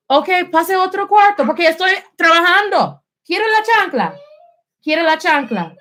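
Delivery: high, insistent maternal warning; scolding parent. Real, not acted. Mother sending child out while she is working, scolding.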